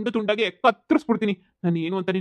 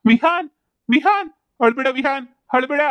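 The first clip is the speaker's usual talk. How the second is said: loud, much higher cry of the son's name; anguished shout; 7 semitones higher, 5 dB louder than usual. Acted. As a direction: yell his name in panic. Father hears son crying. (ಗಾಬರಿಯಲ್ಲಿ ಜೋರಾಗಿ ಕೂಗು)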